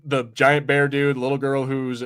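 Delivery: usual speaking voice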